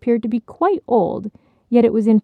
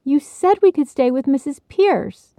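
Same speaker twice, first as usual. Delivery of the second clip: indignant; 6 semitones higher, 3 dB louder than usual. Acted. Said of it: "Bridget reminded indignantly".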